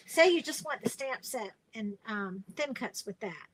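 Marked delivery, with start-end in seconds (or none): none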